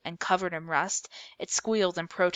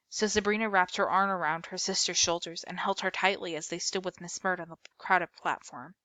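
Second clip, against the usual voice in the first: ordinary delivery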